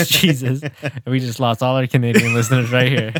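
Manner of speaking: loud laughter through the words; laughing loudly. Real, not acted. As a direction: crack up at the bad accent. Cohost laughs at bad accent.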